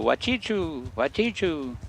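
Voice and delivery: bird call imitation. Streamer imitates the bird's cry, soft and high. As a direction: mimic the plains bird crying "Wachichu". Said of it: "you sit out there and go".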